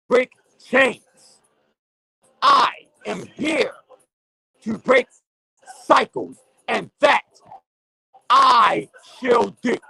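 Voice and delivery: impassioned street preacher rant; fired-up preaching. Real, not acted. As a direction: rail at the listeners like a pulpit sermon. Closing declaration to listeners.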